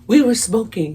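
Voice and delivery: high-pitched, hammed-up confession; breaking down confessing. Goody two shoes admitting it to the officer, acted breakdown. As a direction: cave in and wail the admission. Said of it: "I just break".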